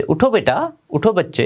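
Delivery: put-on high, gentle coaxing to a child; friendly coaxing. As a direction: coax a shy child, not a command. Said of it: "command नहीं थी ये, एक friendly suggestion था". (Teacher बच्ची को प्यार से मनाती हुई)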